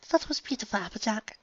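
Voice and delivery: high, soft voice; tender, overwhelmed praise; Rarity moved. Acted.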